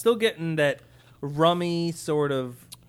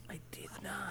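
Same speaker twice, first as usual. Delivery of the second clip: hushed whisper of denial; 16 dB quieter than usual. Real, not acted. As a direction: whisper it like a secret.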